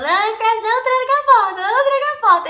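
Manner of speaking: high, loud urgent warning as a little sister; mock sister pleading. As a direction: beg her not to lock the door. Sister warning about the lock.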